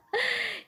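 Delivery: a quick high giggle; short laugh. Real, not acted. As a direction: laugh lightly at your own lion voice. Teacher laughs after lion line.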